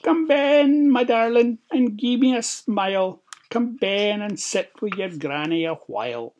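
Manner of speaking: high fake granny, loud at first then easing; put-on old lady voice. A narrator's reading. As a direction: coax the child in as a pretend granny. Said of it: "put on his best old lady's voice".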